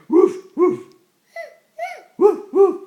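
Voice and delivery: very loud, high woofs; dog bark. Pretend. Parent naming the dog, then high loud barking. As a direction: yap out loud paired barks.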